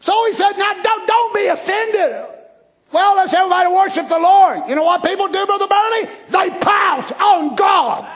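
loud, high shouted sermon; loud, rising pulpit shout; fiery preaching. Acted. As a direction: preach it with full fire. Preaching at full force.

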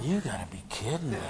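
low, soft mock disbelief; imitated quiet incredulity; retold disbelief. Acts the brothers' disbelief.

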